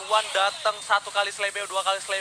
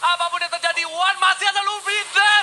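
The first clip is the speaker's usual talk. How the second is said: fighting game announcer; 9 semitones higher than usual. Acted. Hyped esports casting.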